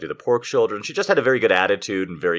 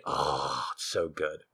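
softer, lingering on so; savoring murmur; 4 semitones lower, 8 dB quieter, slower than usual. Real, not acted. Host savoring remembered sauce, soft murmur. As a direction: let it fall soft, savoring the taste.

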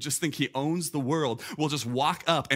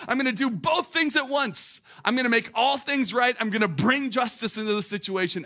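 fired-up preaching; 9 semitones higher, 5 dB louder than usual. Real, not acted. Preacher hammering the point home.

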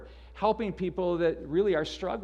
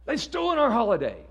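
mock grievance; 7 semitones higher, 6 dB louder than usual. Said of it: "get angry around Christmas time".

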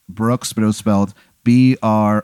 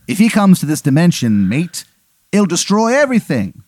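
urgent warning in fake English, pitched up; Henry the eighth, bad English accent; 6 semitones higher than usual. King warns of his rival, in a bad English accent. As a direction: warn them in a hammy bad English accent. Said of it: "it's a very bad English accent".

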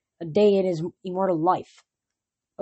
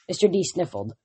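ordinary delivery

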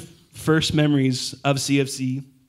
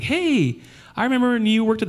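much higher, fast, excited hello; excited greeting; 10 semitones higher than usual. Retold or imitated. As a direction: greet them brightly. Voicing a church family.

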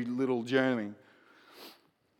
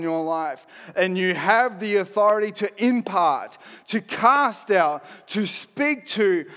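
higher, louder, rising preacher's fire; fervent preaching; 4 semitones higher, 4 dB louder than usual. Acted. Preacher rallying the congregation, loud and fervent. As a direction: drive it home to the church. Preaching builds to full force.

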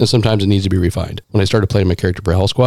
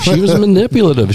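emphatic mock outrage; 12 semitones higher, 3 dB louder than usual. Listing the villain's traits.

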